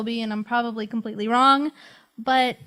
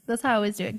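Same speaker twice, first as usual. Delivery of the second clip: ordinary talk, nothing marked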